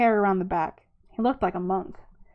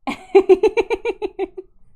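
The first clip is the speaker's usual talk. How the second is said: high, loud rippling laugh; high giggle; 10 semitones higher, 10 dB louder than usual. Real, not acted. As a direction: burst into a delighted high-pitched laugh. Breaks off reading, laughs at rat.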